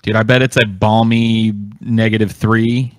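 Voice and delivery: ordinary talk, nothing marked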